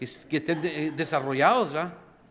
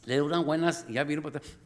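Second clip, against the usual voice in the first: normal talking voice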